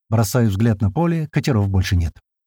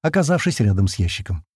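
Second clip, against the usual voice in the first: ordinary delivery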